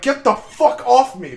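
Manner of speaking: high, loud shout to get it off; panicked shout with pitch jumping up; retold yell. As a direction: yell it while kicking the dog away. Kicking off the biting dog.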